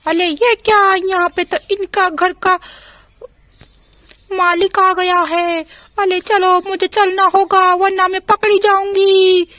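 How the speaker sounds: witch voice. Acted. Witch caught in the house.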